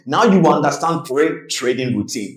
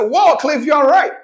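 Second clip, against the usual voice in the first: high, impressed exclamation; acted amazement; 13 semitones higher, slower than usual. Friend tells Cliff the water worked, high acted amazement. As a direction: marvel in a much higher voice.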